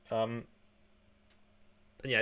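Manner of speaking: ordinary talk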